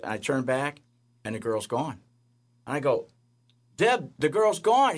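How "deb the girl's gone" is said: astonished. Retold or imitated. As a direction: call out to your wife in disbelief. Shocked the girl vanished.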